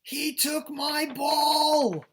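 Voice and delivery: very high, very slow loud wail; drawn-out high yelling. Acted. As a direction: yell it out like a big-letter line. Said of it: "That means he's yelling with the exclamation point".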